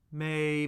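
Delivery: "May" held high and flat; first tone. Shown as a demonstration. Teacher cycling the tones with a syllable, "May" high and level. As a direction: say "May" on a high, unchanging pitch. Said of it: "we'll do the first tone".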